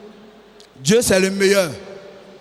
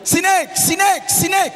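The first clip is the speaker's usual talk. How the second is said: excited calling; 7 semitones higher, much slower than usual. Retold or imitated. Everyone greets Snake.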